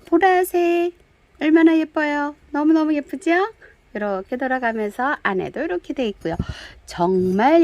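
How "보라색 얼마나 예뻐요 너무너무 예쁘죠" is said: higher and louder, bubbling with delight; delighted seller's gush; excited gushing. Real, not acted. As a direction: show off the pot like it's a treasure. Gushing over purple pot.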